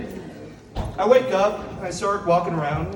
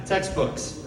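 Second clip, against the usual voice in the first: usual speaking voice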